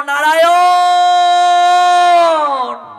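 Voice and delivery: long, high, loud cry of a name; desperate calling out. Acted. Frightened dying Ajamil calls his son, long loud cry. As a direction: call with all your heart, holding the last vowel. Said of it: "সঙ্গে সঙ্গে তার ছেলেটাকে ডাকলো".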